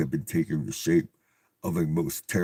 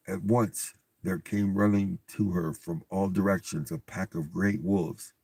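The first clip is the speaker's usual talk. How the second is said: usual speaking voice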